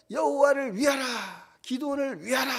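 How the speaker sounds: rally the troops with a cry; battle cry. Retold or imitated. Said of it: "그냥 적진에서 소리를 지르는 겁니다".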